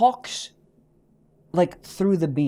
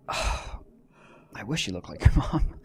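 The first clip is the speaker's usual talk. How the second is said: sighing lament; 11 semitones lower, 9 dB quieter, slower than usual. Real, not acted.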